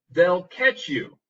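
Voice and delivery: loud, slow ominous read; slow dramatic warning. Shown as a demonstration. Teacher reading Jack's warning, slow and loud. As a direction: warn him slowly. Acting dialogue example.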